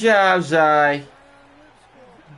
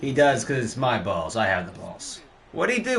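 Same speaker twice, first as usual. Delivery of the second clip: ordinary talk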